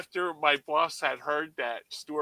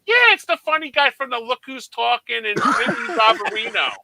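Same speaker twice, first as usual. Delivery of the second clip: mock clueless mom; 9 semitones higher, 8 dB louder than usual. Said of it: "she goes".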